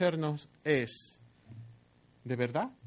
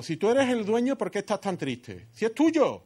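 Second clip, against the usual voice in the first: goading; 6 semitones higher than usual. Acted. Preacher voicing Jezabel's goading.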